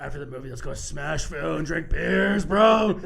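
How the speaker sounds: building to a loud bro shout; frat bro. Retold or imitated. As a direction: hype it up, shout the final bro. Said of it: "Using the whole world".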